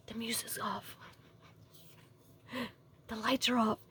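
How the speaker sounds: hushed, secretive whisper; very soft, cautious reenacted whisper. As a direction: whisper it so nobody overhears. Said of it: "so then I start whispering".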